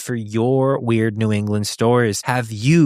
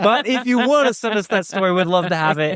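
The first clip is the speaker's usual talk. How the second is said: much higher, sing-song take-it-back; host undoing his joke with a much higher cheeky turn; playful reversal; 9 semitones higher than usual. Pretend. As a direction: flip to a bright cheeky about-face. Ironic reversal after joke.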